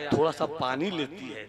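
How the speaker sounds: ordinary talk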